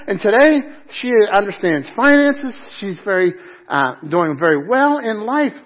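loud, high preaching push; fiery pulpit delivery; preaching at full force. Acted. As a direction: drive the point home to the congregation.

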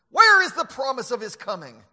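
loud, mocking scoffer's question; scoffer's challenge. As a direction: demand it like a scoffer.

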